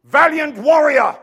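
loud, slow, high proclamation; proclaiming. Acted. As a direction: call him out boldly. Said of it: "he says this".

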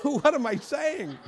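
high, slow incredulous question with a laugh; mock astonishment. Preacher reliving his shock at himself, astonished. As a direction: act amazed at your own words.

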